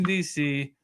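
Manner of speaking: normal talking voice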